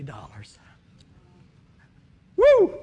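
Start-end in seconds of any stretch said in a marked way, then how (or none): 2.4-2.7 loud, high whoop of joy; shouted woo, very high; joyful whoop. Real, not acted. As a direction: let out a big celebratory woo. Celebrating getting the guitar.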